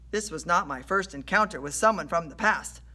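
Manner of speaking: ordinary delivery, nothing marked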